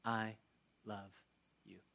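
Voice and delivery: slow, quiet, each word set apart; mean every word, slowly; sincere I love you. Shown as a demonstration. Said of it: "I want you to hear the words from me".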